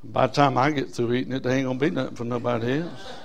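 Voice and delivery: low, soft, mock-selfish mumble; a hint of a tightfisted churchgoer, fast at the end; stingy grumbler. Preacher mocking a stingy believer, soft and low. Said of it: "Jesus didn't look out there and say".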